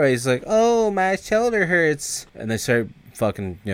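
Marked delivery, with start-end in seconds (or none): 0.5-2.2 high, slow mock complaint; mock whining. O-Dog teasing his friend about the shoulder, whining. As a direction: drag it out as a teasing whine.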